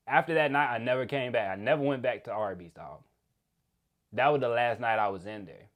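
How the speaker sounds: ordinary talk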